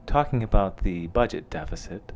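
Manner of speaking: low, soft late-night radio host; lightly voiced smooth NPR DJ. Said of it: "You sound like one of those cool N P R D Js".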